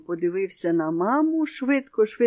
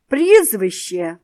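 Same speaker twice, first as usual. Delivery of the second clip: much louder, slow bellowed demand; raised voice; 10 dB louder, slower than usual. A narrator's reading. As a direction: bark it out slowly the third time. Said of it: "підвищивши голос". (гучно, повільно, підвищеним голосом)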